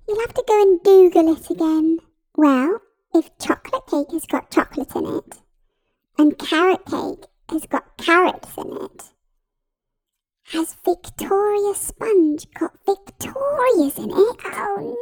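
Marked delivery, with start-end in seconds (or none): none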